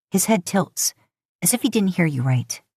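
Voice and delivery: usual speaking voice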